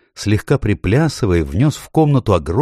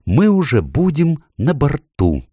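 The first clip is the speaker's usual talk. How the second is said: high-pitched eager faun; 8 semitones higher than usual. A narrator's reading. Faun promising escape by night, eager. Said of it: "добавил Фавн".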